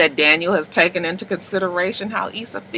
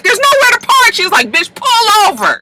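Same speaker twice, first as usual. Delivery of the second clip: mock panic; 5 dB louder than usual. Retold panic in the car.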